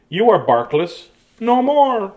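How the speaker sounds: ordinary delivery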